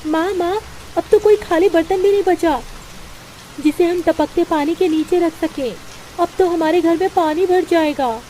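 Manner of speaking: put-on a young girl fretting to her mother; small daughter worried about the leaking roof; worried little girl. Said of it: "अपनी माँ से कहती है".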